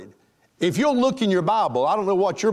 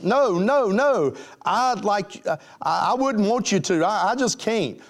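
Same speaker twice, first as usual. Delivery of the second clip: higher, stammering mock protest; flustered refusal; 5 semitones higher than usual. Girl turns down her pleading date, flustered refusal. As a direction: refuse in a playful acted fluster.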